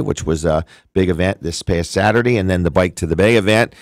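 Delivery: ordinary delivery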